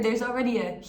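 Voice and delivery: normal talking voice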